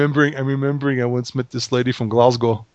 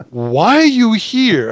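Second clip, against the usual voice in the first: higher, slow puzzled question; worried surprise; 8 semitones higher, much slower than usual. Retold or imitated. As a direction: act startled by the sudden visitor. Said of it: "I would go like".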